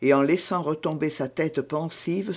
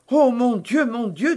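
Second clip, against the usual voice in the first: high, anxious cry, starting louder; alarmed exclamation; 7 semitones higher, 5 dB louder than usual. A narrator's reading. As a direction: exclaim in dread of bad news. Frightened of possible bad news.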